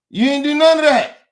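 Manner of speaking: real angry yell; angry shout. Real, not acted. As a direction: snap it out hard. Angry rant at friend.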